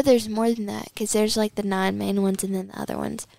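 normal talking voice

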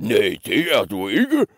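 mock roar with rising swoops; roaring troll. Troll forbidding the middle goat, swooping roar. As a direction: growl a refusal as a troll. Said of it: "brølede trolden".

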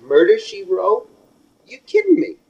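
high, loud disbelief; you can't be serious, right?; incredulous. Real, not acted. Incredulous rant at critics.

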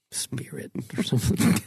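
whisper. Real, not acted. Joking about a seance.

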